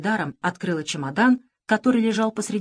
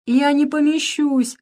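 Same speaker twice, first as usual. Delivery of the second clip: slow, higher whine; whining; 9 semitones higher, 4 dB louder, slower than usual. A narrator's reading. Fat pirate whines to Rat he won't fit, slow and higher. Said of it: "заныл Весельчаку".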